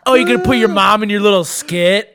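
lightly voiced drunk mother, accusing; nasty drunk mom. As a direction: imitate a wounded, mean drunk mom. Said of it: "nasty drunk like that sometimes".

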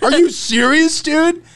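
high-pitched indignant outburst; incredulous, hammed-up anger; mock mad. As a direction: imitate an outraged guy. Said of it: "the Serbian fellow was mad".

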